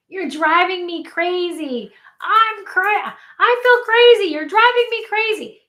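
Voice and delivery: high, loud exasperated whine; mock whining kid. Child complaining to dad, high loud whine.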